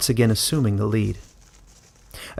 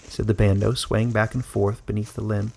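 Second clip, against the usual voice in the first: plain reading voice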